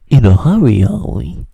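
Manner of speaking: loud, smug and cheeky; smug quip. Retold or imitated. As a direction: deliver it like a cool comeback. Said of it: "he just goes".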